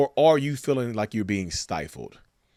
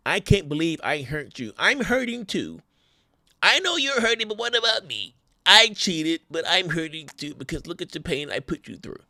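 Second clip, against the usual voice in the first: high, whimpering self-pity; mock the cheater's poor-me apology; whiny fake apology; 6 semitones higher than usual. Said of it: "apologies that are centered around their pain".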